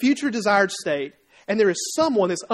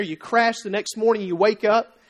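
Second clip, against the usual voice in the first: usual speaking voice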